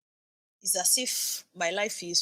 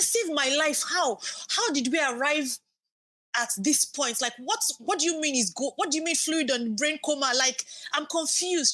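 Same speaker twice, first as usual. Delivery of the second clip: much higher, frantic retelling; retold panicked protest; 7 semitones higher than usual. Patient confronting the consultant about fluid on the brain, panicked. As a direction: re-enact pleading, bewildered questions at high pitch. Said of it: "I started crying and shouting".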